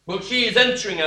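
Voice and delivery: usual speaking voice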